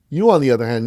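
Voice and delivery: You singled out with a loud push; emphatic. Retold or imitated. Coach stressing the contrast.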